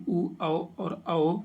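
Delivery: slow, separate "उ, औ और औ"; धीरे-धीरे स्वर उच्चारण; vowels spelled slowly. Shown as a demonstration. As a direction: sound out "उ, औ और औ" one at a time, slowly. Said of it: "पश्च स्वर कहलाते हैं".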